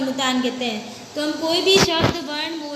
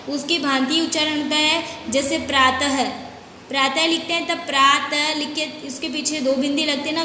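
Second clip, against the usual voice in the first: ordinary talk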